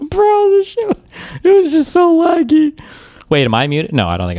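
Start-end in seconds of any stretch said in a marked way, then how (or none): 0.0-2.8 high, squealing helpless laughter; losing it laughing, voice shot way up. Real, not acted. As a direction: wheeze the words out while cracking up. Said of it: "Sorry, I was laughing".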